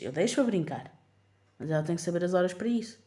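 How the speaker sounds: normal talking voice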